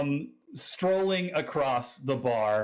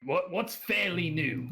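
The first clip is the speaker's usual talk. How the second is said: higher, puzzled Australian question; Australian accent; 6 semitones higher than usual. Acted. Drunk local questions the newcomer, Australian accent. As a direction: query it as a tipsy Australian local. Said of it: "that's my Australian accent".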